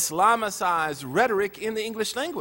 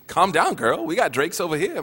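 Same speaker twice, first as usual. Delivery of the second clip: ordinary delivery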